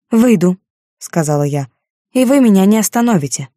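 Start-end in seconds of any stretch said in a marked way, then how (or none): 0.1-0.6 high, loud one-word defiance; defiant retort. A narrator's reading. 2.1-3.5 high, loud one-word defiance; defiant retort. A narrator's reading.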